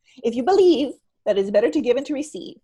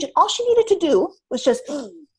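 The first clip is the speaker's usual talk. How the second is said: usual speaking voice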